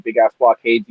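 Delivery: normal talking voice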